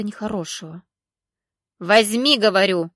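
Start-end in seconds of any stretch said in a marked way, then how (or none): 1.8-2.9 loud, spiteful insistence; insistent vendor. A narrator's reading. Market vendor forcing greens on the girl, loud and spiteful. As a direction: push the greens on her angrily. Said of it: "настаивала торговка, злобно сверкая".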